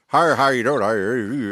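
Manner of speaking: lightly imitated East Coast Canadian accent, mumbled; a hint of a Halifax local greeting you; mumbly Halifax accent. Visitor imitating Halifax locals' greeting, lightly mumbled East Coast accent. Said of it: "it's mumbly".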